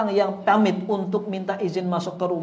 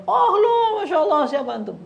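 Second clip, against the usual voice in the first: high, loud mock-chummy greeting; acting overly familiar; 12 semitones higher, 8 dB louder than usual. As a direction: gush as if you're old friends. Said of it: "kayak so akrab gitu". (sok akrab, heboh bernada tinggi)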